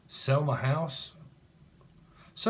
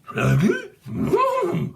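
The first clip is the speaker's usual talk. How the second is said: very high, loud imitated dog yowl; cartoon dog noise; 5 dB louder than usual. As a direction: squeal out a cartoon dog sound.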